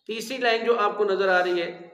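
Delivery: ordinary talk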